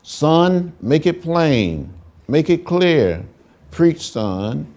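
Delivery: loud, high cry urging the son on; urging father's call. Retold or imitated. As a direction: call out like a father in the pews spurring his preaching son. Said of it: "Daddy King would say to his son".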